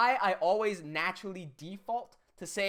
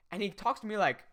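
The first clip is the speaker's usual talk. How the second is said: ordinary delivery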